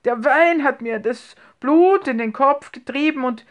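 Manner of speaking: embarrassed, halting and groping for words; stammering. A narrator's reading. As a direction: stumble and repeat words, hunting for an excuse. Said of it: "stammelte Herr Ochs".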